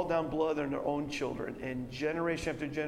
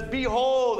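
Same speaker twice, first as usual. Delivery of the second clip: proclaiming; 7 semitones higher, 8 dB louder than usual. Acted. Pilate presents Jesus to crowd.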